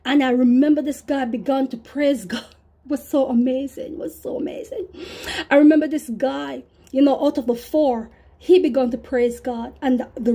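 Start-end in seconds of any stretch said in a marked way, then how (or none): 3.9-4.9 a hushed, reverent aside; awed whisper. Real, not acted. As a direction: breathe it out in a hush. Hushed repeat of the same line.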